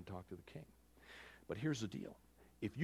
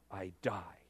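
whispered, softer acceptance; resigned whisper; 6 semitones lower, 6 dB quieter than usual. Retold or imitated. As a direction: let it fall to a whisper. Esther resigned to possible death.

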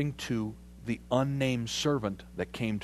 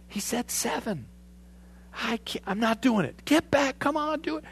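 much higher, strained, arguing with himself; inner argument; 13 semitones higher than usual. Retold or imitated.

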